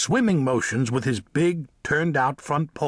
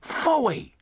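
very high, scornful little mole voice; contemptuous mole; 12 semitones higher than usual. A narrator's reading. Mole dismisses the cat's threat, contemptuously. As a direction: scoff in a high squeak. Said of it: "said Samuel contemptuously".

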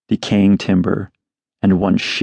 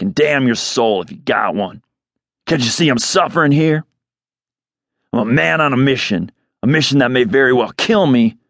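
higher, desperate angry ranting; 5 semitones higher than usual. Acted. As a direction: rant at the bird in despair. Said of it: "desperate man's rant".

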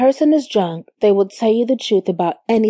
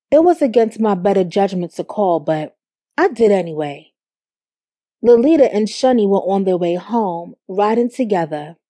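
ordinary delivery, nothing marked

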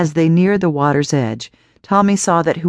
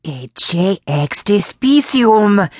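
growling; 4 semitones higher, much slower than usual. A narrator's reading. Old woman growling Latin at Tommy, slow and menacing. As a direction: growl it into his eyes. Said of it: "now growling".

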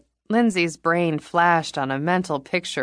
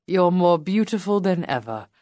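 put-on British accent; 2 semitones higher than usual. Robbie speaking, British accent.

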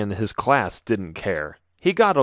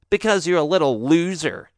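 higher sneering bully voice; 5 semitones higher than usual. A narrator's reading. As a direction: sneer it at the kid. Bully insults Nathan.

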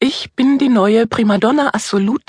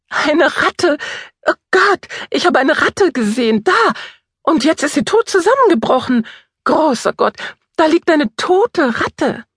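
shrieking in panic; 6 semitones higher than usual. Acted. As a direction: scream out in horror at a rat. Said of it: "In diesem Moment gellt jemand auf. Laut. Sehr laut". (panisch kreischend)

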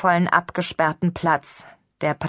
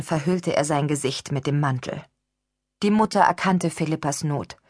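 ordinary delivery, nothing marked

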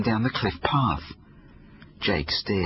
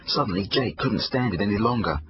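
ordinary delivery, nothing marked

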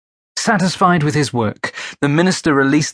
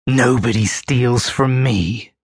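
rasped through gritted teeth. A narrator's reading. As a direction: squeeze it out through clenched teeth. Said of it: "Whelan rasped through gritted teeth".

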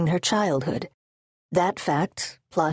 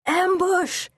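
very high, urgent warning cry; shouted alarm; 13 semitones higher than usual. Acted. Guards warning of a sudden attack, high-pitched shout. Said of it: "shouted the guards".

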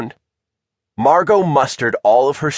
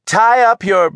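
high, raised-voice instruction; calling down; 9 semitones higher, 4 dB louder than usual. A narrator's reading. Tilda calls down to the raft, projecting. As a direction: call it down from a ship's deck. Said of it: "she called down to them".

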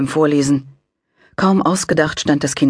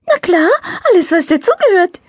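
high, light angel voice; little angel voice; 6 dB louder than usual. A narrator's reading. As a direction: play a cheerful little angel. Angel character voice.

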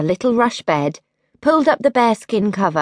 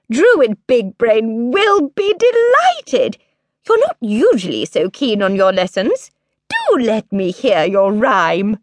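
pleased mother; 5 semitones higher than usual. A narrator's reading. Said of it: "surprised and pleased".

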